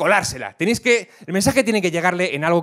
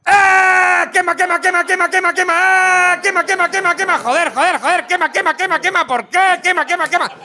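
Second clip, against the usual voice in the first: burning snail screaming; 11 semitones higher than usual. Pretend. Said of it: "el caracol va a llegar y va a hacer".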